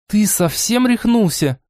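high, sharp scolding fairy. Acted. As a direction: yell it at him in disbelief. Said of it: "руганью высказалась".

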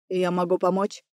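low, soft male character voice; ex-husband's voice. A narrator's reading. Narrator voices the ex-husband offering help.

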